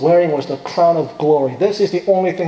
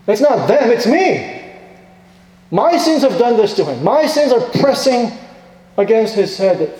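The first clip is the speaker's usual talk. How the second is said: raised, higher, urgent preaching voice; impassioned preaching; 5 semitones higher, 3 dB louder than usual. Acted. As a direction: preach it with rising fervour, pleading with the congregation.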